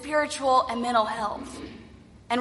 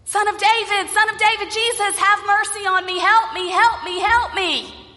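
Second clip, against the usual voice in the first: higher, more urgent pleading cry; crying out louder; 6 semitones higher than usual. Acted. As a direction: beg louder over the crowd. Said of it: "cried out all the more loudly".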